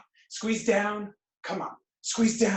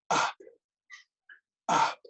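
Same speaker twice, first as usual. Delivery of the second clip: ordinary talk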